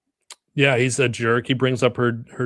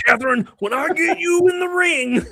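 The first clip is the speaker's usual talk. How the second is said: high wrestler trash talk; wrestling promo. Pretend. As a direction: hype it like a wrestler. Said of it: "You just did wrestling again".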